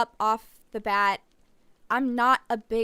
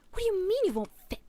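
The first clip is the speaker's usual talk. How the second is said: exasperated; 10 semitones higher, faster than usual. Acted. Exasperated outburst in game.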